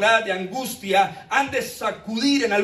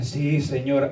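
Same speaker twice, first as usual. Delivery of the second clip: meek servant voice; 8 semitones lower, slower than usual. Retold or imitated. Messenger meekly answers.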